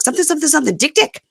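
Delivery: high gibberish mimicry of a husband's retelling; imitating relaying in another language. Husband relays the fight to his family, mock gibberish. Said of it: "He'd be like".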